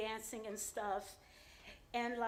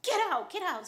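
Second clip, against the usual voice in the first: higher, louder angry yell to get out; angry order; 7 semitones higher, 8 dB louder than usual. Retold or imitated. Furious client throwing the dancer out, higher and louder. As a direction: bark it like a furious man.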